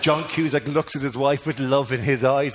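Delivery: ordinary talk, nothing marked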